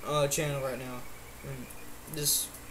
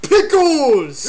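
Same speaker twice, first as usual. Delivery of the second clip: high, very loud drawn-out yell; rowdy shout; 5 semitones higher, 10 dB louder than usual. Pretend. Host joins the pickle chant, rowdy shout. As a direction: holler it like a battle cry.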